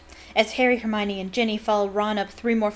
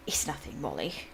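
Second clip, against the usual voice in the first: low, soft mumble; sheepish low mumble; mumbled; 6 semitones lower, 9 dB quieter than usual. A narrator's reading. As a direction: mumble it away, much lower and softer. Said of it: "mumbled Mister Weasley".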